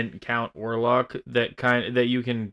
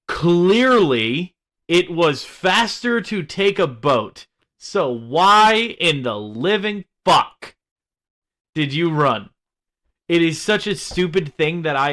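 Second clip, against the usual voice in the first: exasperated rant; 5 semitones higher, 6 dB louder than usual. Real, not acted. Ranting about a character's choice.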